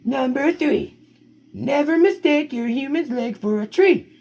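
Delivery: high, lively storybook read; reading to children. Acted. Parent reading the dog's story to kids, high and lively. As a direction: tell the puppy's tale brightly to the kids.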